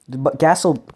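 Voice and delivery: normal talking voice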